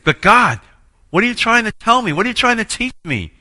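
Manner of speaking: high, loud, pleading protest at God; defensive outburst. Retold or imitated. Man protesting to God in prayer, loud and pleading. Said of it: "And I got defensive".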